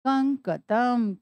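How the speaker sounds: कंकतम drawn out slowly, each syllable clear; slow reveal of the word. Shown as a demonstration. Teacher to students revealing the word, slowly. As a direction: spell कंकतम out in sound, slowly. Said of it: "क्या बना पूरा शब्द मिलाकर?".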